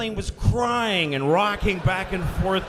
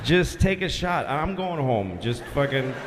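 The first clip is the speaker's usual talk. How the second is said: low, halting, couldn't-care-less delivery; mildly fed-up tower guy giving up; indifferent controller; 6 semitones lower than usual. Acted. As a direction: shrug it off with weary pauses. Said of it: "That's how he said it, like".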